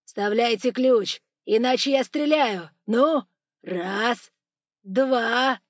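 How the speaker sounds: furious yelled orders; sudden yell. A narrator's reading. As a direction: roar an ultimatum and count. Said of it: "и вдруг заорал".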